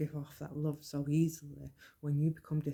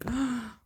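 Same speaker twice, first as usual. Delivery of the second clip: mock gasp; 8 semitones higher, 5 dB louder than usual. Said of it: "unpopular opinion".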